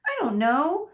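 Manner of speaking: a hint of a desperate plea; pleading. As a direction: beg for the works, gently. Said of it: "she pleaded".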